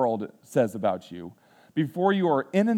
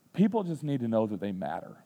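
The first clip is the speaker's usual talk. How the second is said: softer, gentle fatherly words; tender advice; 4 semitones lower, 6 dB quieter than usual. Retold or imitated. Dad teaching son kindness, soft and sincere. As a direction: quietly tell your son what matters. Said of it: "That's what I told him".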